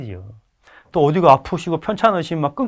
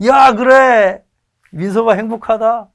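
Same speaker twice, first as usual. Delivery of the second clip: happy father; 8 semitones higher, 7 dB louder than usual. Retold or imitated. Father answering son happily.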